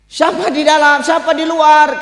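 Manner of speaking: loud, high shouted question; acted shout to a caller; gatekeeper's challenge. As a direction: call out sharply through the gate. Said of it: "Kata malaikat Malik".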